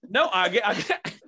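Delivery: ordinary talk, nothing marked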